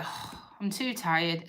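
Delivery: slow, soft, worn-out mock dog voice; imitating an exhausted pet, quietly; tired dog. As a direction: voice a weary dog giving up, dragging the words. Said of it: "he'd try a bit and then be like".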